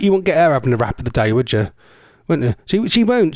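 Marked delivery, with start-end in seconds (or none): none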